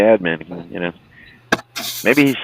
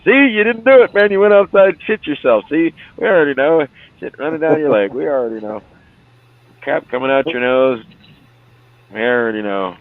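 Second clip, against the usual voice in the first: mocking taunt; 6 semitones higher than usual. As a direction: jeer at him for failing. Friends taunting him.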